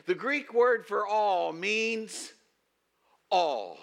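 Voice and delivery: slow, high build then a long pause before "all"; slow loud setup, pause, then "all"; dramatic pause before ALL. Shown as a demonstration. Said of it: "try to explain the Greek".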